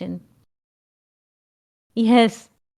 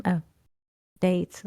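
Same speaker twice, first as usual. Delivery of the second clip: normal talking voice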